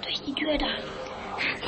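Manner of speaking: slow hushed whisper. Acted. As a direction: whisper the direction. Urging escape while hiding.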